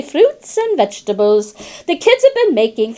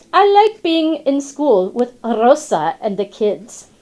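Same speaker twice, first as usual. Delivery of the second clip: plain reading voice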